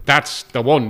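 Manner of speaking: loud, pleased Cavan exclamation; Cavan accent. Retold or imitated. Driver recognising the show title, loud Cavan accent. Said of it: "I'm gonna attempt a Cavan accent".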